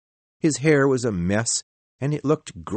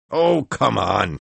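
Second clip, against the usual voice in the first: slow, louder scoffing protest; objecting; 5 dB louder, slower than usual. A narrator's reading. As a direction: dismiss the idea outright. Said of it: "objected the editor".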